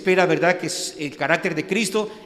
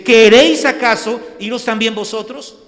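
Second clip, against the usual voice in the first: loud, higher, forceful question; stern challenge; 5 semitones higher, 11 dB louder than usual. Retold or imitated. Jesus confronting the twelve disciples, loud stern challenge. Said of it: "no se lo dijo en tono suave".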